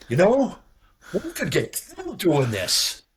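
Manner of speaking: high, slow, dopey voice; a hint of a dim leader; mock dawning realisation. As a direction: play the slow-witted leader.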